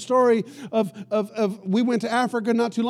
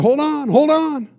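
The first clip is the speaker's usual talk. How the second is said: "Hold on, hold on" higher, louder and slower; urgent warning; 6 semitones higher, 7 dB louder, slower than usual. Retold or imitated. Pastor warns his companion to guard the bags, loud and urgent. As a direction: press the warning hard, drawn out.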